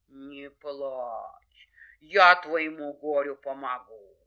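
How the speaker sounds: low, drawn-out bear voice; reassuring bear. Acted. Bear promises the hare help, ponderous.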